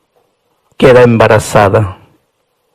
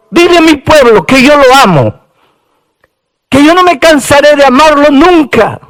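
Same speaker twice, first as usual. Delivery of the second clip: full-force preaching; 13 semitones higher than usual. Acted. Preacher proclaiming God's love for his people, impassioned preaching. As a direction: preach it out, voice soaring.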